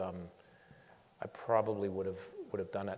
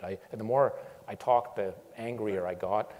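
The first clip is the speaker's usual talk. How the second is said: normal talking voice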